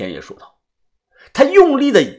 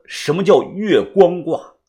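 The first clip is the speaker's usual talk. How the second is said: plain reading voice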